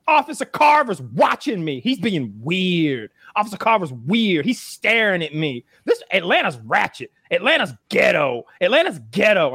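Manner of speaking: high mock sassy complaining; sassy woman. Guest imitating the woman complaining on the phone, high and sassy.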